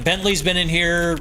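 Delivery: ordinary talk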